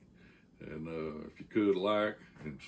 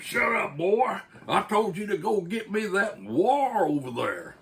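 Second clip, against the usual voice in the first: much higher, louder angry bark; angry shout; 12 semitones higher, 7 dB louder than usual. Retold or imitated. Lineman shuts down the back-talking grunt, angry shout. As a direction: snap him down for back talk.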